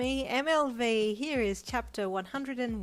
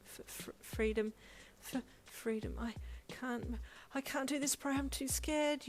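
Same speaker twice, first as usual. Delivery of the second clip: higher, softer stuttering wail; scared wail; 7 semitones higher, 3 dB quieter than usual. Acted. Bride losing her nerve, stuttering wail. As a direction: stammer in rising panic. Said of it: "you wailed".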